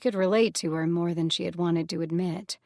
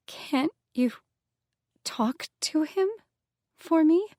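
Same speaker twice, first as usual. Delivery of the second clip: higher, halting plea; a reluctant plea climbing in pitch; struggling plea; 10 semitones higher, slower than usual. Acted. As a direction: force the words out, rising higher. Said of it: "She seemed to struggle saying those words".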